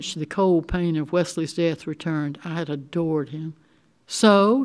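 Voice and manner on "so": louder, higher nudge to continue; impatient, raised question; impatient prompting. A narrator's reading. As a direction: prod for the rest of it. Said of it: "she prompted".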